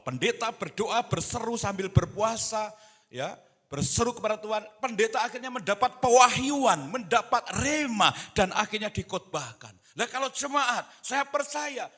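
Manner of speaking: loud, high, driving pulpit delivery; full-force preaching. Real, not acted. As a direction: press it home at a high shout. (khotbah berapi-api, lantang dan bernada tinggi)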